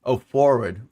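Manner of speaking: normal talking voice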